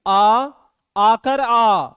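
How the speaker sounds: आ sounded loud and open from the throat; vowel demo. Shown as a demonstration. Teacher showing pupils the throat sound आ, loud and open. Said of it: "क्या कहेंगे?".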